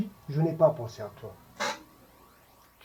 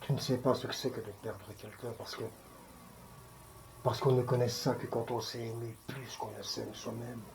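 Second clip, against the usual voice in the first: hushed, low-pitched sorrow; douleur contenue; 3 semitones lower, 9 dB quieter than usual. Acted. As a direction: speak softly and low, holding the grief in. Acted evocation of losing a loved one. (voix basse, chagrin contenu)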